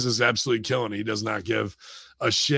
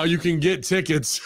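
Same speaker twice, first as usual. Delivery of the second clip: usual speaking voice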